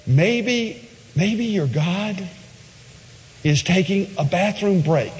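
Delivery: mock taunt. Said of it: "He, he even says".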